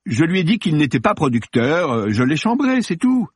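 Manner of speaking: high, hesitant self-justification; flustered defensiveness. Acted. Actor explaining himself to police, flustered. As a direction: protest innocence, pitch climbing at the end.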